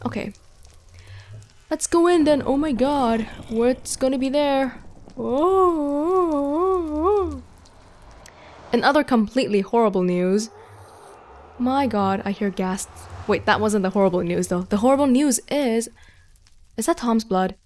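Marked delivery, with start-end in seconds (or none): none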